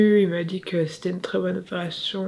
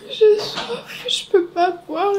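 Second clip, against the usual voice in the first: groggy after anaesthesia; 11 semitones higher, 7 dB louder, slower than usual. Real, not acted. Waking from anaesthesia, distressed.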